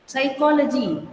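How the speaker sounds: psychology begun on an S sound, P silent. Shown as a demonstration. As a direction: say psychology without any P sound. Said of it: "the P will become silent".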